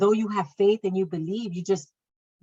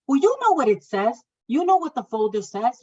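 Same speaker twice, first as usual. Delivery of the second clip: louder, higher, exasperated reply; angry retort; 6 semitones higher, 5 dB louder than usual. Retold or imitated. Mother telling the midwife she has read the file, angry and raised. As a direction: throw it back at her, fed up.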